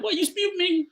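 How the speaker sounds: high grandma voice; imitating grandmother. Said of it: "I'm like".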